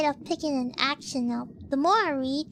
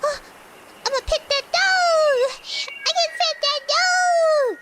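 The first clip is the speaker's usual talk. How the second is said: excited exclamation; 10 semitones higher, 5 dB louder than usual. Acted. Gamer spotting a dog to pet, excited exclamation. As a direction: burst out at spotting something to pet. Gleeful reaction to a dog in game.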